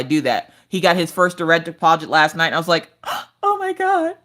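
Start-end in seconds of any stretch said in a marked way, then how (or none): 3.4-4.1 high, laughing joy, almost crying; elated squeal. Real, not acted. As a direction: squeal with relief over the paycheck. First direct deposit arrives.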